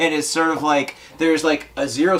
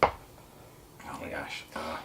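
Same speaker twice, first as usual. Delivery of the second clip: whispered; 8 semitones lower, 15 dB quieter, much slower than usual. Real, not acted. Whispered reaction to strong drink.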